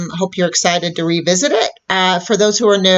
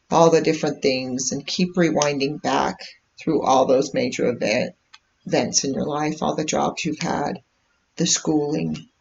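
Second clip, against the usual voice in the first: unhurried meditation voice with long pauses; calm, measured guidance, leaving space between phrases; guided meditation; 2 semitones lower than usual. Real, not acted. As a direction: guide listeners gently inward, phrase by phrase. Leads a live meditation.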